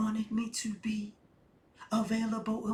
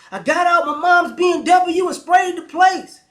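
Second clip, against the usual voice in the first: shouted, much higher wannabe rapper boast; suburban gangster rapper; 9 semitones higher, 14 dB louder than usual. Pretend. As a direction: hype it up like a fake tough rapper. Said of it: "You sound like a suburban gangster rapper".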